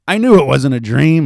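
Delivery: loud, high-pitched exclamation; shout. A narrator's reading. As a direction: shout it out to the child. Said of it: "Muller shouted".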